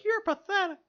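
very high squeaky puppet voice; high-pitched, scornful little hand; talking hand. Acted. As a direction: give your hand a tiny high-pitched voice to scorn you. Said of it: "Even my hand, like, looks at me. It's like".

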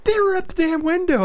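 high, loud imitation of an angry ex; retold scolding. Ex telling him off about the kids at the window, imitated scolding. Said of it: "And she's like".

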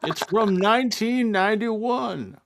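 put-on high old grandpa character voice; chirpy old man showing off. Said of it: "Grandpa Dan".

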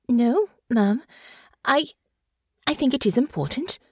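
a hint of flustered young lady; flustered young Honor. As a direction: stumble but insist.

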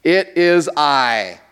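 slow, each word weighted on "It is I"; emphatic pronoun. Shown as a demonstration. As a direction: stress the I in "It is I". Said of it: "emphatic personal pronoun".

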